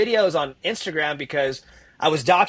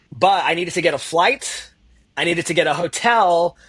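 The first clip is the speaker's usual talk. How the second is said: usual speaking voice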